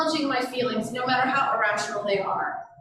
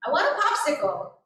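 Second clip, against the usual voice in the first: put-on higher whiny child request; child asking; 5 semitones higher than usual. Child asking parent for a popsicle, higher whiny request. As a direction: mimic a kid begging for a treat. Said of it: "what they want".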